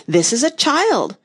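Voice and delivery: high, drawn-out eager introduction. A narrator's reading. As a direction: introduce her eagerly, voice lifted and slow. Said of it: "Heya replied eagerly".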